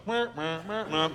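droning muted wah wah, laughing; blah-blah noise mocking dull talk. Preacher mocking robotic prayer with a droning wah wah. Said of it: "It's just like".

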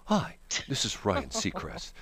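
slow, hushed classical announcer; classical radio host. Acted. Ryan Seacrest introducing a classical station, slow soft announcer voice. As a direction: speak softly and unhurriedly, like classical radio. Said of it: "then you turn to a classical station".

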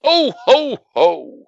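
high, loud mock Santa "ho ho ho"; Santa laugh. Co-host interrupts the holiday plug as Santa, jolly. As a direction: belt out a hammed-up Santa laugh. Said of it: "Oh, Santa".

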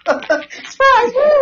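high, whooping surprise cry; mock cheering. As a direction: burst out cheering. Pranksters jump out cheering.

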